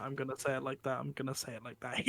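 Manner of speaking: defiant repetition. Real, not acted. Defiant repeats after being warned.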